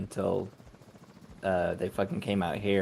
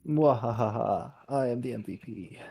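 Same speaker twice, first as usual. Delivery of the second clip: sneering panto villain. Acted. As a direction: gloat like a pantomime baddie. Gloating over top score.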